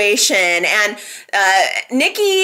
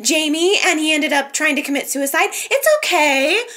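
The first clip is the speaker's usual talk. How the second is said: mock coddling; 7 semitones higher, faster than usual. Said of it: "everybody just rushes to her to be like".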